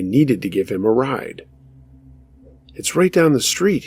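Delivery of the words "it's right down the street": higher-pitched, mildly voiced insistence; insisting stranger, lightly acted; insistent. A narrator's reading. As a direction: keep pressing the point, pitch raised. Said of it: "he kept insisting".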